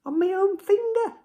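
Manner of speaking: high excited squeal. Real, not acted. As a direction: squeal it with delight. Excited about own result.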